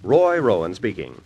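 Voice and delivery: normal talking voice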